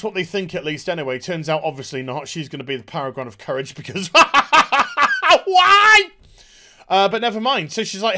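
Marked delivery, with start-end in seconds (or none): 4.1-5.4 high, loud forced cackle; loud mock laugh. Reviewer scorning the plot twist, loud hammed-up laugh. 5.4-6.1 high, loud drawn-out cry; exasperated wail. Pretend. Reviewer despairing at the plot twist, mock wail.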